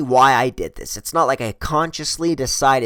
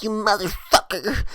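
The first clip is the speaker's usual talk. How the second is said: mock furious curse; 7 semitones higher, 3 dB quieter than usual. Driver curses him for peeing.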